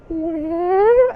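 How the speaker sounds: high, steady imitated electric whine; whine impression. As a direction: mimic the motor's little whine, badly. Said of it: "That's a terrible impression".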